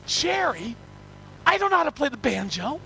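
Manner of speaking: high-pitched pleading, played for laughs; mock panicked protest. Visitor pleading with brother-in-law about the banjo, mock panic. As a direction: protest to a relative in comic panic.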